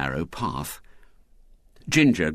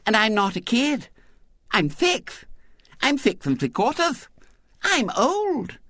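put-on very high lisping child, sentence by sentence; meek little girl. A narrator's reading. Girl defending her age to William, meek. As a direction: insist meekly in a small girl's voice. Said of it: "in a tone of disarming meekness".

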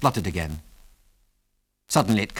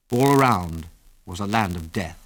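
ordinary delivery, nothing marked

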